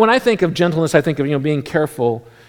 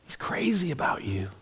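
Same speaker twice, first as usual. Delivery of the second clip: put-on whispered reassurance to a child; soft whispered comfort; tender whisper; 11 dB quieter than usual. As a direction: whisper it like a secret to comfort him. Reassuring a worried kid.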